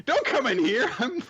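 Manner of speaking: mock upset. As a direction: shoo them out in a hammed-up high voice. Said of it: "he's really ups".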